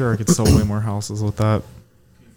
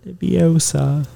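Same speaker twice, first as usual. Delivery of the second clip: very slow, creepy-sounding British attempt; 4 semitones higher, slower than usual. Shown as a demonstration. Friend trying British on request, slow and creepy. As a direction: draw the word out in a bad British accent. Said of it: "You sound like a pedophile".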